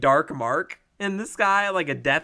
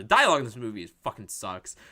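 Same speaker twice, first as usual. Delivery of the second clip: usual speaking voice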